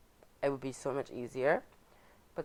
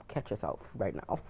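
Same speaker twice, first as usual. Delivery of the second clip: low, softer note to self; lowered private reminder; self-correcting aside; 6 semitones lower, 5 dB quieter than usual. Retold or imitated. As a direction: act out quietly checking yourself. Acts out telling herself off.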